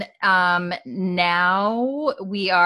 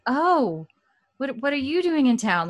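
high-pitched awkward surprise; awkward greeting; 7 semitones higher than usual. Retold or imitated. Claire greets Roger mid-fight, acted awkward. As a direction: stumble into an over-polite question. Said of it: "it's a little bit awk-, awkward".

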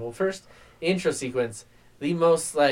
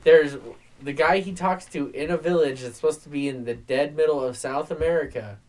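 ordinary delivery

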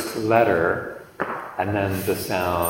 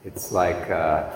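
ordinary talk

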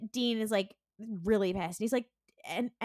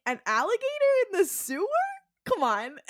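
high, louder disbelief at a silly idea; incredulous scoff; 11 semitones higher, 4 dB louder than usual. Retold or imitated. Dean scoffing at his brother's sewer alligator theory, incredulous. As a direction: scoff as if it's absurd.

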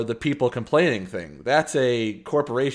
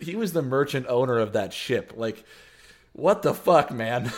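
ordinary talk, nothing marked

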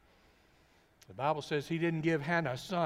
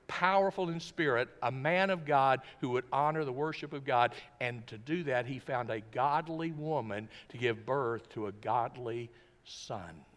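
ordinary talk, nothing marked

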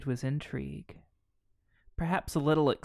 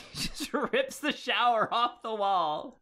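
laughing; 7 semitones higher, slower than usual. Real, not acted. Laughing recounting scene.

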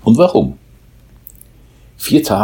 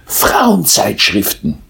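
happy mole; 8 semitones higher, much slower than usual. A narrator's reading. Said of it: "sagte der glückliche Maulwurf".

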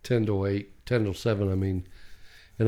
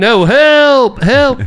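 high, loud cry through a door; calling for help; 11 semitones higher, 9 dB louder, much slower than usual. Retold or imitated. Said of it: "I was like".

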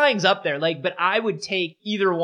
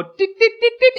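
very high doot doot; squeaky fish doots, louder; fish noise; 6 dB louder than usual. Retold or imitated. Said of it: "puff up and go".

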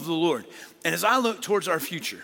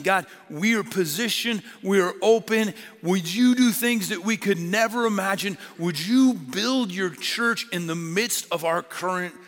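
fervent prayer; 3 semitones higher than usual. Acted. Prayer rising in force.

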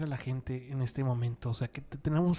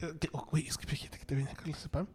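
whispering; 9 dB quieter than usual. Pretend. Joking about a dealer offering pills.

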